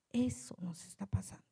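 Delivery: eso nos está pasando whispered, hushed, low; whispered aside before saying it aloud. Acted. Whispered, then repeated aloud.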